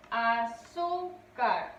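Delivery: "Azúcar" slowly in separate syllables, ZÚ held; a... ZÚ... car, falling at the end of "Azúcar"; stressed middle syllable. Shown as a demonstration. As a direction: stretch the ZÚ in "Azúcar". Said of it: "Su sílaba tónica recae en".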